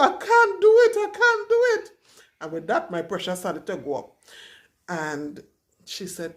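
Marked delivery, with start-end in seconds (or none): 0.0-1.9 high crying plea. Retold or imitated. Reenacting refusal to push in labour.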